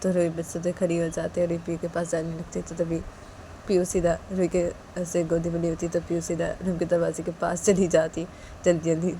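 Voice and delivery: ordinary delivery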